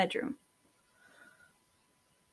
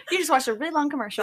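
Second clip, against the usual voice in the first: laughing; 6 semitones higher, much faster than usual. Real, not acted. Joking about fake ad.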